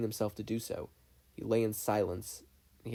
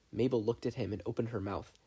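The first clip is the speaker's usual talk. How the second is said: plain reading voice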